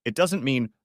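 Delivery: plain reading voice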